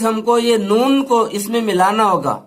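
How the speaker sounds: ordinary talk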